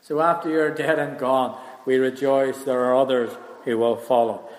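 ordinary talk

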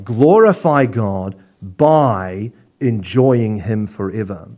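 slow, deliberate "Glorify God by enjoying him forever", leaning on "by"; stress on by. Shown as a demonstration. Preacher giving Piper's rewording, slow and weighted. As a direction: lay it out slowly with "by" carrying the meaning. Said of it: "John Piper suggested changing "and" to "by".

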